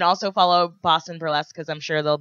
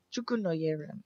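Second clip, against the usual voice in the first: Nigerian accent; 3 semitones lower, 14 dB quieter than usual. Shown as a demonstration.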